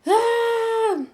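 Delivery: high, sustained mock scream of labor pain; imitated long high cry of agony; acted-out scream. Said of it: "I was screaming".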